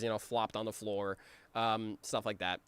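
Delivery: ordinary talk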